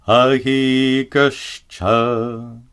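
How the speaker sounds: slow Ahigascha with a hard ga; Sanskrit example. Shown as a demonstration. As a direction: chant Ahigascha slowly, anuswara as ga. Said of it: "For example".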